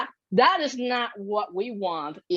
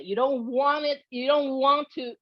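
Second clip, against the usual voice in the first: ordinary talk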